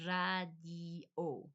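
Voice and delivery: radio split into RRAH, DEE, OH; slow Spanish radio. Shown as a demonstration. Breaking it down for learners, radio as slow RRAH-dee-oh. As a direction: stretch radio syllable by syllable with rolled R. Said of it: "same exact word in Spanish".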